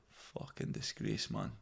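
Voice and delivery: low, slow unvoiced grumble; mock disgusted mutter. Scots reacting to his un-Scottish voice, low disgusted mutter. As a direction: mutter it under your breath, appalled. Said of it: "And they go".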